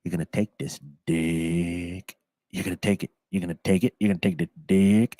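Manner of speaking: imitating Paul Rudd. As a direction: psych yourself up in the mirror, low and husky. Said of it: "He is just like".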